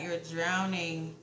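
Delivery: "drowning" stretched out slowly; slow stress on drowning. Real, not acted. Reader warning the viewer, slowly stressing "drowning".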